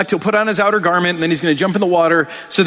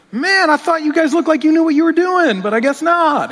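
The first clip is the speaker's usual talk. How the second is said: much higher, playful teasing voice; teasing Jesus; 10 semitones higher than usual. Retold or imitated. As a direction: rib the fishermen like a friendly joker. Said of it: "Jesus standing there going".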